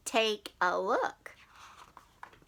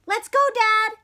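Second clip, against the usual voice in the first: higher, louder eager call; eager kid; 9 semitones higher, 6 dB louder than usual. Acted. Froggy urging his dad to go, eager and high. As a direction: bounce it out like an excited little frog.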